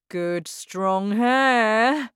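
slow, pompous and self-satisfied; proud father voice. Acted. Father admiring his own hair, slow and pompous. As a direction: savour each word like a vain dad. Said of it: "he often said".

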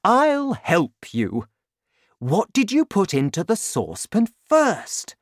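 eager father. A narrator's reading. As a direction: prompt your son to remember. Excited father encourages his son.

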